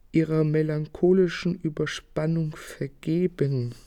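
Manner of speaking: ordinary talk, nothing marked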